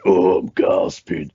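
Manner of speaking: slow mock shout of a kid desperate for a smoke; mock child smoker shouting for a cigarette. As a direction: play a six-year-old smoker, hammed-up and drawn out.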